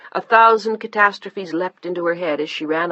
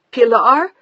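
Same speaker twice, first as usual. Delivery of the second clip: shouted name, higher and louder; shouting; 7 semitones higher, 9 dB louder than usual. A narrator's reading. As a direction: shout the name into the phone in shock. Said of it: "She was shouting into the phone now".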